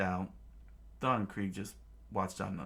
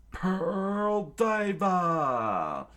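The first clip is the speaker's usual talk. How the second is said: high, slow, loud battle cry; hammy villain attack shout; attack call; 10 semitones higher, 6 dB louder, much slower than usual. Acted. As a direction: stretch the move name out. Attack name called.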